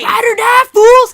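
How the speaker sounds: shouting the Fast and Furious catchphrase; movie shout. Pretend. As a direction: bellow it like an action hero. Quoting the movie loudly.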